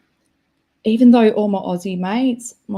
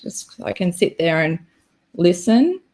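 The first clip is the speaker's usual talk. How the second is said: normal talking voice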